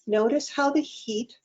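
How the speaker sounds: calm, unhurried meditation guide with long pauses; guided meditation. Acted. Guide leading online participants through breathing and self-massage, guided meditation. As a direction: lead them gently into the breath, phrase by phrase.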